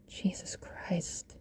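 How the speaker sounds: slow breathy whisper of shock; shocked exhale. Acted. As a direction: breathe it out, stunned. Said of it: "Jack exhaled".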